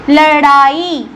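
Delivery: लड़ाई stretched very slowly, flapped ड़; slow demo word. Shown as a demonstration. Teacher modeling the word for pupils: slow लड़ाई. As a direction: pronounce लड़ाई slowly for the class. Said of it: "ठीक है ना, लड़ाई".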